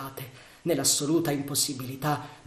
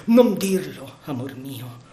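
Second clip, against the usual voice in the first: urgent protest; 6 dB louder, slower than usual. Acted. Lover refusing talk of parting.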